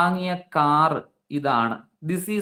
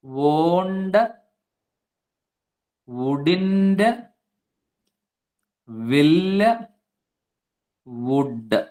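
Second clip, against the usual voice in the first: each option alone, long pause after it; drawn-out words with wide gaps; slow option list; much slower than usual. Shown as a demonstration. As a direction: read out the choices one at a time. Teacher reads out answer options.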